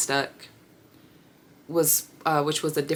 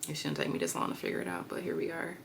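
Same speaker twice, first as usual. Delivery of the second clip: muttering to self; 10 dB quieter, faster than usual. Real, not acted. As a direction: grumble it quickly under your breath. Working out sums to herself.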